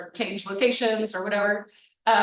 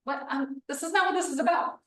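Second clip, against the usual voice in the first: incredulous; 5 semitones higher, 4 dB quieter than usual. Retold or imitated. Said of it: "they're gonna be like".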